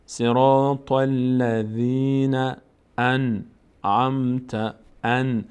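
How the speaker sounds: very slow, sustained tajweed chant; Quran recitation. Shown as a demonstration. Teacher breaks the verse into pieces, slow recitation. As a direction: chant each piece slowly for learners to follow.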